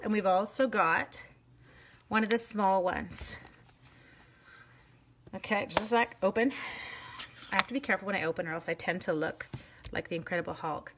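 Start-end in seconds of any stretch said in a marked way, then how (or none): none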